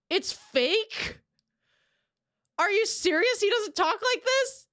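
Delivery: incredulous. Real, not acted. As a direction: blurt it out in shocked disbelief. Learns the accent is fake.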